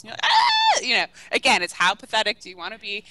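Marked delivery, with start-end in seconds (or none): 0.2-0.8 very high, loud cry of despair; hammed-up scream; mock anguished shriek. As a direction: wail as if heartbroken. Player reacts to the breakup.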